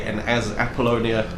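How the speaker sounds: usual speaking voice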